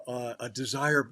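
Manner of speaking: usual speaking voice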